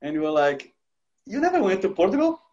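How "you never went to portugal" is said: much higher, incredulous squeal; stunned disbelief. Retold or imitated. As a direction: ask as if you can't believe it. Passengers stunned at the driver.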